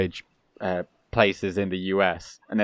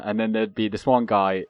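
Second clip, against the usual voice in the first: normal talking voice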